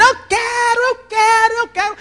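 put-on high child voice chanting demands; unbridled child. Preacher imitating a child who wants everything. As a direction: whine it over and over like a spoiled kid.

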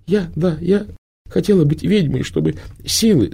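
put-on high, halting girlish voice; stammering girl. A narrator's reading. The girl stammers her reason to Prima, high and halting. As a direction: stumble over the words.

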